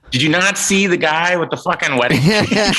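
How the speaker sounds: can you believe this guy; mock disbelief at coworker; incredulous outburst. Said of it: "I'm like".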